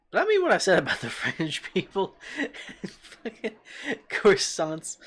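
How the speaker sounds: giggling, voice breaking into laughs; real amused laughter; laughing through words. Real, not acted. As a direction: laugh while saying it. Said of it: "That has gotta be a weird thing to say".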